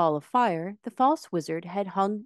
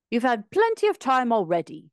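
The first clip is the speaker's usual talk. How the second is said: raised, louder complaint; mildly angry retort at the wizard; 7 semitones higher, 5 dB louder than usual. A narrator's reading. Tin Woodman angrily tells Oz time's up. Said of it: "said the Tin Woodman angrily".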